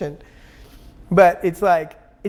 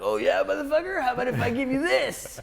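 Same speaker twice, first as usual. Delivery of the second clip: higher, taunting vengeful god voice; angry adolescent God; 5 semitones higher than usual. Pretend. Mocking the vengeful god of religion, in a higher taunting voice. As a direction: sneer it like a petty god out for revenge. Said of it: "The angry adolescent God would".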